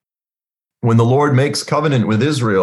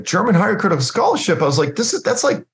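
unvoiced disbelief; 8 semitones higher, faster than usual. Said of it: "express it to him like that".

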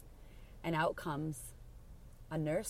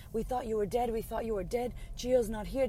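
mock scream, high but held back; imitating a mother's frantic screaming; retold screaming; 6 semitones higher than usual. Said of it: "his mom's screaming".

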